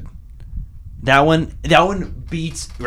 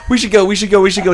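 mock panicked urging; 5 semitones higher, 7 dB louder, much faster than usual. Characters panicking, acted out.

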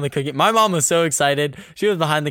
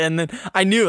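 normal talking voice

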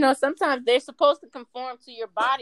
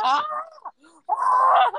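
high, loud retold scream; mock scream; 12 semitones higher, 4 dB louder than usual. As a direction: re-enact a startled shriek, "Ah!". Acting out her own scream in the story.

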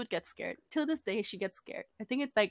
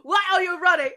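high, raised-voice meme quote ending in a scream; meme imitation; 6 semitones higher, 3 dB louder than usual. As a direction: call it out like the running meme. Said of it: "I just think of that meme".

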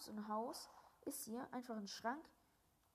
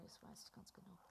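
breathy whisper to the viewers; real hushed aside; 10 dB quieter than usual. Real, not acted. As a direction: whisper it so nobody else hears. Whispering to viewers during call.

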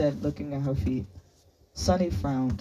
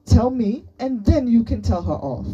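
high, loud, indignant outburst; screeched demand; 9 semitones higher, 6 dB louder than usual. A narrator's reading. Sunny demanding that her friend tell her, screeching. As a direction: demand answers, voice right up. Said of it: "Sunny screeched".

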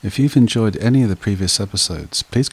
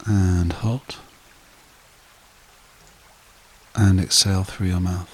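guided meditation; 4 dB quieter than usual. Acted. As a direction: lull them evenly, softening as each phrase settles. Leads a breathing meditation.

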